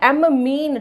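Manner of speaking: M मीन with a loud, held M; double M. Shown as a demonstration. Teacher reminding students of the spelling, M मीन with doubled M. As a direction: hold the M, then land on मीन. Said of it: "double एम लगाना है".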